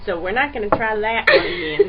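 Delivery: loud laughing voice; laughing through it. Real, not acted.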